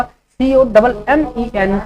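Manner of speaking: slow letter-by-letter dictation; slow spelling dictation; spelling out slowly. Shown as a demonstration. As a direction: spell each letter clearly for students. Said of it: "commentary का spelling क्या होगा".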